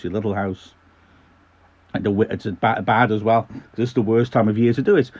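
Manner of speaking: normal talking voice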